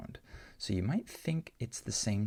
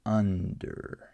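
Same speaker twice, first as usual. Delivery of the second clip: "under" with a full, louder UN; stress on first syllable; 6 dB louder than usual. Shown as a demonstration. Teacher models a stressed short U for learners, first syllable stressed in "under". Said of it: "this is the stressed syllable".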